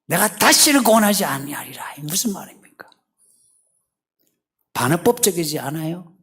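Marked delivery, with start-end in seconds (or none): 0.1-1.9 high, loud threat not truly meant; frustrated irony. Acted. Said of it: "하나님의 그 답답함이 반어법적으로 나오는".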